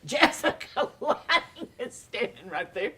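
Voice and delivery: high, loud, slow disbelief; starstruck amazement. Retold or imitated. Actor seeing Jessica on set, exaggerated amazed outburst. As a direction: stretch the name in hammed-up awe.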